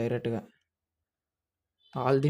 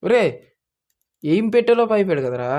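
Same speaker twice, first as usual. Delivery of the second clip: loud, higher, exasperated outburst; frustrated shout; 7 semitones higher, 11 dB louder than usual. Real, not acted. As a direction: yell it at the screen. Gamer shouting at the game. (కోపంగా అరుపు)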